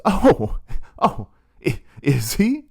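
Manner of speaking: high, startled "oh" trailing off; caught-off-guard surprise. Retold or imitated. Retold presenter caught out by the professor, startled and flustered. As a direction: sound suddenly flustered.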